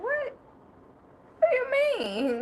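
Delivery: shocked high-pitched what do you mean; offended surprise. Retold or imitated. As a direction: pitch jumps way up in disbelief. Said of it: "I was offended".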